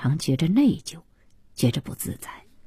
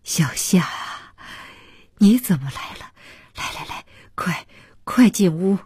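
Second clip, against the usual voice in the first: put-on elderly father's mildly excited welcome; pleasantly stirred at seeing the visitor; 2 semitones higher than usual. A narrator's reading. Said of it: "竟然透出几分的激动".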